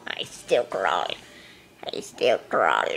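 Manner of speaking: deep slowed voice. Pretend. Player voicing the slow crawling cat.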